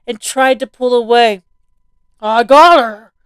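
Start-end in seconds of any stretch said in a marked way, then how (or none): 2.2-3.1 loud gloating shout; triumphant cry. A narrator's reading. Zombie grabbing the girl's hair, loud triumphant cry. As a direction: crow it like you've caught your prey. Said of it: "the zombie cried triumphantly".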